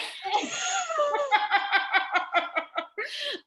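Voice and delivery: long, loud, high laughing fit; helpless laughter. Real, not acted. Host cracks up at her bad accent, helpless laughter. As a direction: crack up uncontrollably.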